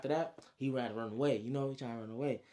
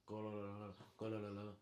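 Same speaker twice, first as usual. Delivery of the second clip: soft, low rolling gurgle; gurgling noise; 3 semitones lower, 10 dB quieter than usual. Retold or imitated. Storyteller imitating the elephant's trunk filling, low soft gurgle.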